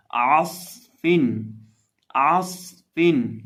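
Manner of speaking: عاصفن with heavy whistled ص, slow and loud; correct pronunciation. Shown as a demonstration. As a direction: thicken the ص in عاصفن and let it whistle. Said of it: "موٹا بھی کریں گے".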